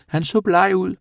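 high, loud little creature voice; animal character. A narrator's reading. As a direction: pipe it up excitedly, far above normal pitch. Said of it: "sagde Rabat". (høj, ivrig dyrestemme)

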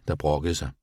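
ordinary delivery

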